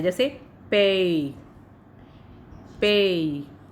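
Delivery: पे with a long drawn ay sound; पे said slowly, vowel held long. Shown as a demonstration. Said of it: "यह ए कुछ लंबा उच्चारण देता है".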